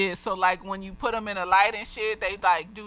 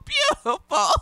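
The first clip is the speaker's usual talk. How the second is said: high, teary gush of feeling between laughs; choked-up real emotion; emotional; slower than usual. Real, not acted. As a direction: overcome and half-crying with joy. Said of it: "the drink is making me emotional".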